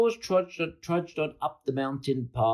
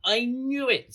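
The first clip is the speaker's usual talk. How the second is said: high, gleeful exclamation; delighted whoop; 3 dB louder than usual. A narrator's reading. George hears the Yeti wants spaghetti, delighted whoop. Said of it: "said George with a whoop of delight".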